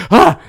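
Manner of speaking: high, loud gasp of fright; startled gasp. Retold or imitated. Recalling dad walking in behind them, startled gasp. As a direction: jump with a sudden "ah". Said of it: "it scared the shit out of me".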